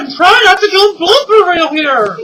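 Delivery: loud, high, fed-up shout. Real, not acted. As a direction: yell it at him in frustration. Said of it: "that was my husband again".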